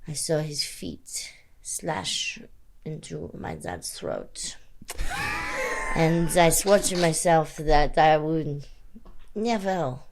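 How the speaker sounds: quietly threatening vendetta speech; hushed villain confession, unhurried; menacing spy villain. Pretend. Spy villain revenge monologue.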